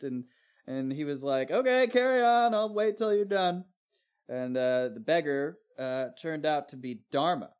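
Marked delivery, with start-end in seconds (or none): 1.5-3.6 very high, loud, chirpy acceptance; cheery husband. Retold or imitated. Husband tells busy wife to carry on, high and chirpy. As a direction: chirp it like nothing's wrong.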